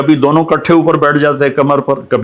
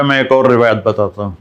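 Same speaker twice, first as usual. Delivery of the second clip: usual speaking voice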